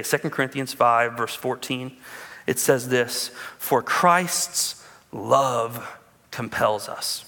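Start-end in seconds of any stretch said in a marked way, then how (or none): 3.6-7.2 very slow, solemn reading; scripture reading. Acted. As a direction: read the verse with reverence.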